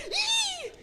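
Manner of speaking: very high panicked gasp; sharp gasp. Retold or imitated. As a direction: gasp in terror. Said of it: "elle fait une rotation".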